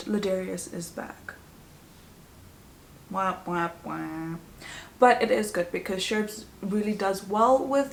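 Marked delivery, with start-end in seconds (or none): 3.1-4.4 falling, fading mock wah-wah; sad trombone. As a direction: sing a deflated wah wah wah.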